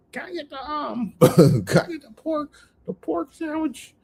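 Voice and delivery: mocking his order. Mimicking the coworker ordering pork in a high squeaky voice. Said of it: "Esau ordering pork".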